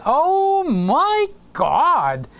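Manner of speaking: high, drawn-out imitated excitement; mock amazement. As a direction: stretch it out as if thrilled for a friend. Reads Lewis's delighted reaction aloud.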